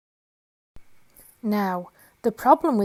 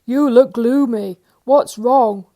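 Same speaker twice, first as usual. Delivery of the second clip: much louder, gruff-free booming question; loud Mister Greedy; 3 semitones higher, 12 dB louder, slower than usual. Acted. Mister Greedy asking Mister Tall, loud. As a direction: boom the question.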